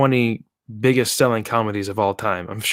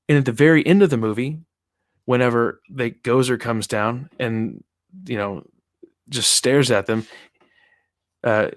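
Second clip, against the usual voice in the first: normal talking voice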